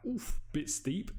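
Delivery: high, slow mock wince at the price. Friend balks at the hourly rate, mock wince. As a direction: drag out a pained oof. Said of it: "they're like".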